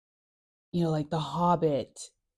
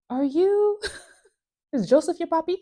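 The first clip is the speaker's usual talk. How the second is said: teasing townsperson; 12 semitones higher, 4 dB louder than usual. Retold or imitated. Crowd doubting Jesus, teasing.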